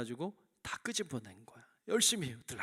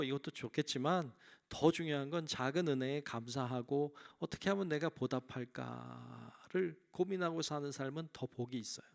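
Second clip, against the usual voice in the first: ordinary talk, nothing marked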